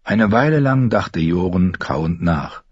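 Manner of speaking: ordinary delivery, nothing marked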